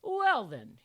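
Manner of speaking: high, loud mock British accent; grandly performed British accent. A narrator's reading. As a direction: put on a theatrical British accent. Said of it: "best British accent".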